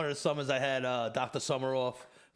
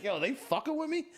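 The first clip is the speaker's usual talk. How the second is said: incredulous; 12 semitones higher than usual. Retold or imitated. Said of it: "I'm like".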